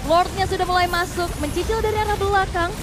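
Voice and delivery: ordinary talk, nothing marked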